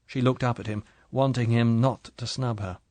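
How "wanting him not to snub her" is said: plain reading voice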